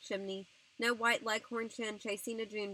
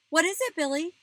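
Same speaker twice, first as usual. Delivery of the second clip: high, loud, alarmed question; scared voice; 8 semitones higher, 10 dB louder than usual. A narrator's reading. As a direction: ask in alarm, voice raised. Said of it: "Mama asked in a scared voice".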